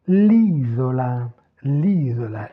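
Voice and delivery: "l'isola" with a long first ee; slow teacher's model, "l'isola" as LEE-zola; lengthened stressed vowel. Shown as a demonstration. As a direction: draw out the I in "l'isola". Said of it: "toujours l'allongement vocalique".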